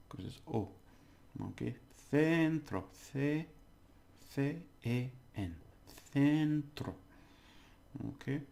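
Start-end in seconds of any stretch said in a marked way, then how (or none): 2.1-6.9 centro slowly as "THEN-tro", higher first syllable. Shown as a demonstration. Teacher drilling the Spanish sound, C said as th. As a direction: stress a th at the start of centro. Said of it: "C before an E is th".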